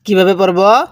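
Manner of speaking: slow drawn-out tajweed chanting; slow recitation. Shown as a demonstration. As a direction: recite slowly for students to echo. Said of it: "হ্যাঁ, পড়ুন".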